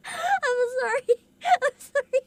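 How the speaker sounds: apologising slowly between laughs and tears; sorry while laughing and crying; laughing through tears. Real, not acted. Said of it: "the immediate shift to the crying".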